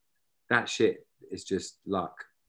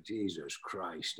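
Sean Connery muttering; 11 dB quieter, much slower than usual. Retold or imitated. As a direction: grumble it in Connery's Scottish accent, slowly. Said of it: "you can do the accent perfectly".

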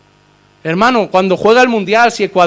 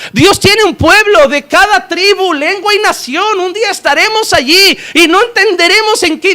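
fiery preaching; 10 semitones higher, 5 dB louder than usual. Real, not acted. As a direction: preach it full force to the congregation. Preaching at full force.

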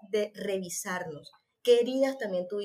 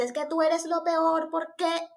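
higher, slow, accusing yell; high, slow, scolding shout; mock yell; 7 semitones higher, 3 dB louder, slower than usual. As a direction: imitate a parent yelling at a child. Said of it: "Te grita, o sea, te grita literalmente".

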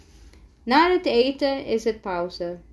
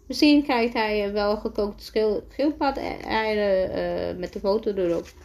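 usual speaking voice

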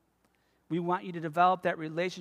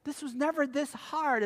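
mild father-in-law; 7 semitones higher, 4 dB quieter than usual. Retold or imitated. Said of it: "he's even saying".

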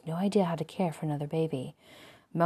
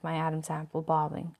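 ordinary delivery

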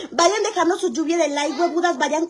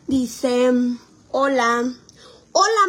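ordinary delivery

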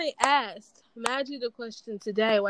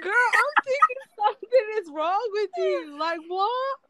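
incredulous; 8 semitones higher than usual. Retold or imitated. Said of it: "I'm like".

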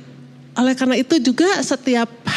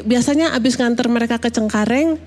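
ordinary talk, nothing marked

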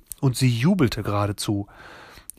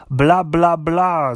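higher, louder mocking blah-blah; dismissive; 6 semitones higher, 6 dB louder, much slower than usual. A narrator's reading. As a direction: brush the argument off impatiently. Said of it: "Grandmère und winkte ab". (abwinkend, genervt)

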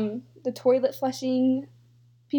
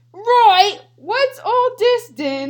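high, loud, slow mock British; badly faked British accent, hammed up; terrible British accent; 8 semitones higher, 9 dB louder, slower than usual. Reading a British people tweet, terrible British accent. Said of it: "My British accent is literally terrible".

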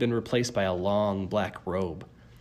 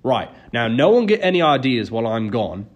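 louder announcement to a crowd; announcing loudly; 8 dB louder than usual. A narrator's reading. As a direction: call out to the waiting room. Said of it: "he announced".

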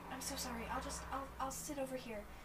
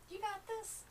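high encouraging voice; 12 semitones higher, 3 dB quieter than usual. Acted.

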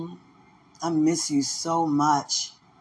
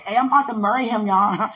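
fast, higher, proud shout-out; excited announcement; 6 semitones higher than usual. Retold or imitated. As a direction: announce it fast like big news. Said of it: "I am so proud to say".

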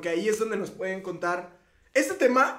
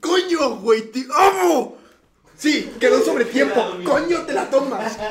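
shouted declarations of love, higher and louder; ecstatic yelling; 7 semitones higher, 9 dB louder than usual. Real, not acted. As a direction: yell it out in triumph. Celebrating the win excitedly.

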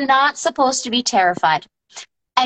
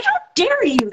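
mock offended How dare you, pitched higher; playing up being insulted, high and indignant; offended outrage; 9 semitones higher than usual. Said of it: "And I was offended".

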